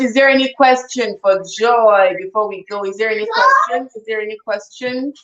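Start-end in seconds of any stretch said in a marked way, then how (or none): 3.0-3.9 high, raised call to the audience. Real, not acted. Host calls out to viewers in a high voice. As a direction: pitch the question up high.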